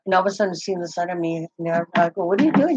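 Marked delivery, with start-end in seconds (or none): 2.2-2.8 surprised question. Retold or imitated. Husband suddenly sits beside her.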